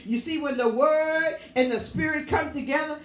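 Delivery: driving, insistent pulpit delivery; fervent preaching. Real, not acted. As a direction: preach it hard, pressing on without letting up. Preaches at full force.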